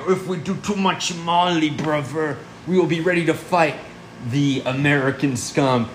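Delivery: hammed-up German party-goer accent; mock German accent. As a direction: put on a comic German accent, defiant. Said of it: "they're all like".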